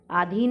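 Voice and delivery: अधीन with a long first vowel, "aa-dheen"; mispronounced अधीन. Shown as a demonstration. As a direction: stretch the opening a of अधीन. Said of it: "नहीं होता". (अधीन का गलत उच्चारण, "आधीन")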